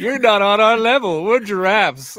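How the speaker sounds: high, haughty giraffe voice; snooty giraffe voice. Acted. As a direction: look down your nose at everyone. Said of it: "I love the voice".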